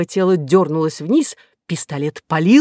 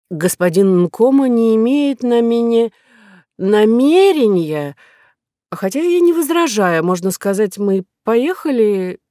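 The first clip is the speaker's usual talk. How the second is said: tipsy woman; 6 semitones higher, 5 dB louder than usual. Acted. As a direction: let the words wobble like a drunk. Tipsy Kora, drunk on liqueur.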